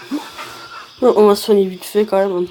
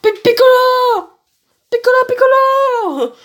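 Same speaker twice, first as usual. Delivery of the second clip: anguished mock cry; 12 semitones higher, 4 dB louder than usual. Gohan cries out for fallen Piccolo, anguished mock cry. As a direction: wail the name over and over, hammed up. Hammed-up cries for dying friend.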